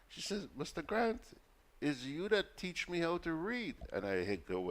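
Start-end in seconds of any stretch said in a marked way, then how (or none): 0.6-3.8 high-pitched impression of a country vendor; mock high voice of an anxious caller; rural vendor woman. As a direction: imitate an uneducated market woman, pitched way up. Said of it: "somebody from the rural districts".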